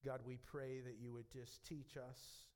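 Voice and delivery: pastor leading the closing prayer, hushed. Real, not acted.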